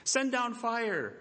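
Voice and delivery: high, strained pleading cry to a silent god; frantic Baal prophets. Retold or imitated. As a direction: call out like desperate worshippers begging an idol. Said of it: "They shouted loudly".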